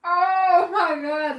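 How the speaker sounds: drawn out in disbelief; overwhelmed gasp. Real, not acted. Overwhelmed by scene.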